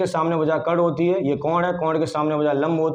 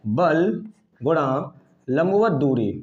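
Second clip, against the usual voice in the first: slow formula dictation; 2 semitones lower, much slower than usual. Shown as a demonstration. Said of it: "बल युग्म आघूर्ण का सूत्र होता है".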